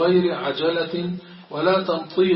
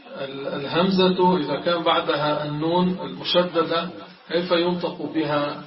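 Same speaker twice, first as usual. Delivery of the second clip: normal talking voice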